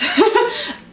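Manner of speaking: quick high chuckle; a laugh breaking the sentence; light giggle; brief laugh. Real, not acted.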